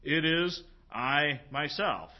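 "It is I myself" slow, each word set apart and stressed; slow, forceful declaration of "It is I myself"; emphatic. Shown as a demonstration. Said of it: "Notice how emphatic this is".

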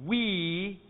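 we drawn out, as in "queen"; pronunciation demonstration. Shown as a demonstration. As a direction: say we slowly as one gliding sound. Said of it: "That is pronounced".

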